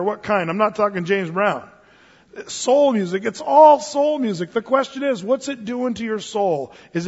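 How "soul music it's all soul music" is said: ALL rising, louder and higher; emphatic preaching. Real, not acted. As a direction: drive it home to the congregation. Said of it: "preaching emphasis".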